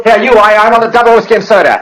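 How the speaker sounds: curt loud order. Acted. Husband barking drink order.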